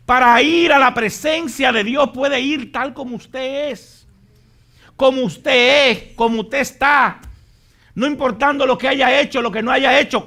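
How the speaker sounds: loud, high, impassioned sermon delivery; fiery preaching. Acted. Pastor urging the congregation toward God's throne, full force. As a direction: preach it to the rafters.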